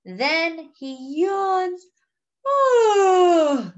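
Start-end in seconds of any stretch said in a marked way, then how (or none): 2.5-3.7 big open yawn sound; loud yawn. A narrator's reading. The narrator acts out Franklin's yawn, loud yawn. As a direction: yawn it out loudly like a sleepy dragon. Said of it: "very loudly".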